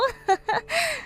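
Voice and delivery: shrill tipsy giggle; drunk high cackle. Acted. Drunk Patsy laughing at the bride, high giggle.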